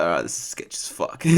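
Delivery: muttered under breath; whisper. Retold or imitated. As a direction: whisper it warily. Retold thought, unvoiced.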